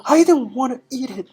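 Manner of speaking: put-on high, loud child's protest; boy's voice. Boy refusing Trunchbull's cake, high and loud. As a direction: whine it up high like a stubborn kid. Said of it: "the boy said".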